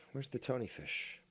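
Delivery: ordinary talk, nothing marked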